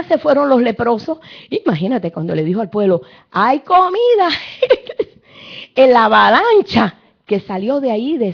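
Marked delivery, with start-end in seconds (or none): 3.3-4.3 high, loud cry of good news; joyful shout. Acted. Lepers announcing food to the starving people, joyful shout. 4.3-5.0 high laugh. Real, not acted. Excited laugh after the news.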